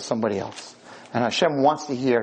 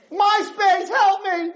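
desperate scream; slower than usual. Retold or imitated. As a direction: shriek at the idol to save you. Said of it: "started to scream the name".

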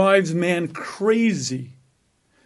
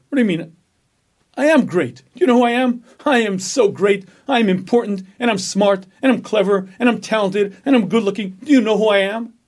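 loud, puffed-up bragging, higher and louder; boastful inner voice; 4 semitones higher, 4 dB louder than usual. Acted. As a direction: strut it like the most important man alive. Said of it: "Or there's an alternative".

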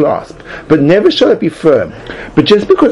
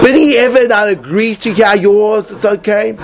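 higher, slow, magnanimous turn-down; gracious decline; 7 semitones higher than usual. Shown as a demonstration. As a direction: graciously wave off the honour. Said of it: "make it look as if".

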